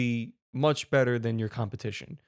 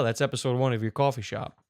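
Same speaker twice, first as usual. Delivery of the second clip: usual speaking voice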